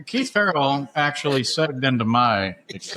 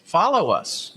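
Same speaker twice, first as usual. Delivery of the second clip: normal talking voice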